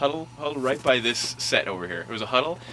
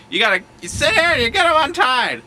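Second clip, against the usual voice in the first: high, loud mock yell; monitor's scolding order; 8 dB louder than usual. Monitor ordering boy to untie shoes, high scolding shout.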